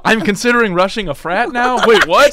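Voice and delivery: rising high, loud panic; bewildered. Acted. Acted escalating bewilderment.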